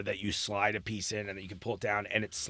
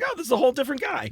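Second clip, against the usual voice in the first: delighted; 12 semitones higher, faster than usual. Retold or imitated. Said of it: "I was like".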